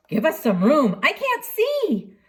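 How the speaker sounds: a hint of a pushy bystander; crowd complaining. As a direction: play a frustrated crowd member who can't see. Crowd pushing in story.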